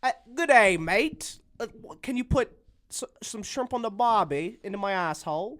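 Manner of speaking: loud, high hammed-up Australian accent. Friend asks for Australian, he hams it up loud. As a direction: bellow it like a cartoon Australian. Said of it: "Uh, Australian".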